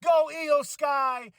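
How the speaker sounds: high, slow victory cheer; cheering shout. Retold or imitated. Vlogger retelling his cheer for Io Sky's win, an elated shout. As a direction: shout it jumping out of your seat. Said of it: "I jumped out of my seat as I said".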